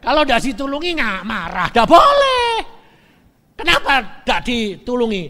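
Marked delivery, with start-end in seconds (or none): 1.7-2.5 forbidding shout. Real, not acted. Preacher forbids anger.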